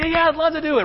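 high, eager volunteer; hammed-up passionate agreement; all excited. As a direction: gush with mock zeal. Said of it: "All excited, all zealous, all passionate".

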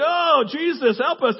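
half-laughing cry for help; laughing plea. Retold or imitated. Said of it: "I was like".

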